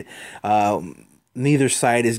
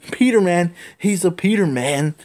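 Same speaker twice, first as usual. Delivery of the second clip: higher, louder teasing chant of Peter Man; playful sing-song; 7 semitones higher, 3 dB louder than usual. Real, not acted. Reader jokes about Captain Stacy calling him Peter, playful teasing. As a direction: toss off a silly joke on the name. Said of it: "playful joke on the name".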